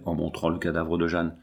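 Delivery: ordinary delivery, nothing marked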